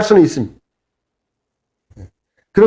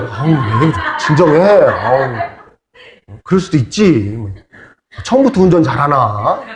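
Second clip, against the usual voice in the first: lightly imitated gentle coaxing with a laugh; mock-soothe the angry driver, half laughing; soothing calm-down; 5 semitones lower, slower than usual. Pastor calming his wife behind the wheel, lightly soothing. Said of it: "이제 옆에서 내가 말려".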